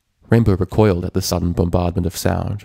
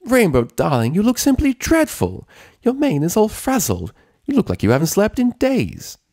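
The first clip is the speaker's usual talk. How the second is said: much higher, theatrical posh-pony outrage; fulminating Rarity; 13 semitones higher than usual. A narrator's reading. Rarity scolding Rainbow about her mane, indignant. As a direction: scold with dramatic flair. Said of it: "a fulminating Rarity".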